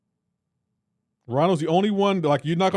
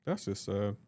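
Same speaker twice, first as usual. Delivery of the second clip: much lower and softer, deflated; mock pitying; 8 semitones lower, 11 dB quieter, slower than usual. Real, not acted. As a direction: sigh it out in pity. Repeated pitying verdict.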